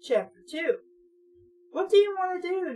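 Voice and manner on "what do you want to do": quick, pushy and higher; demanding. A narrator's reading. Nat pressing his brother for a plan, quick demanding tone. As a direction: fire the question off impatiently. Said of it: "Nat demanded".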